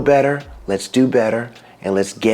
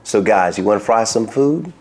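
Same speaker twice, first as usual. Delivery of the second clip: usual speaking voice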